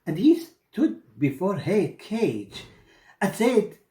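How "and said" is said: ordinary delivery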